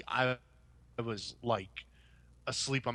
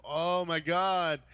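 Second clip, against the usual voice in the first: exasperated groan; 4 semitones higher, 4 dB louder, much slower than usual. Real, not acted. Bored by memory of movie.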